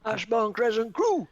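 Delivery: loud, high crew call; shout. Acted. Co-host shouting the crew name that should stay secret. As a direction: bellow the address out proud. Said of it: "Don't shout it".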